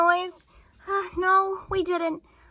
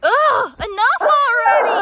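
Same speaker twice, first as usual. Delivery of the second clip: drawn-out exasperated shout, much louder; frustrated outburst; 4 semitones higher, 10 dB louder, slower than usual. Acted. Girl fed up with the noise at the sleepover, exasperated shout. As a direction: let it burst out, fed up with the noise. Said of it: "Now I'm getting frustrated".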